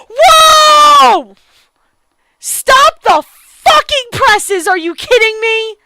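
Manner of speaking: loud, high shouted disbelief; real stunned yelling at a twist; shocked outburst. Real, not acted. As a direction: yell at the screen in disbelief.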